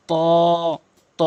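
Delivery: ত with a long rounded vowel; one-alif stretch. Shown as a demonstration. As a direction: draw out ত for one count. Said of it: "এক আলিফ করে টেনে পড়বেন".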